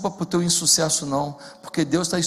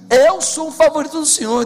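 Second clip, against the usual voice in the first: proud believer; 8 semitones higher, 5 dB louder than usual. Pretend. Fiel declara fé imitado.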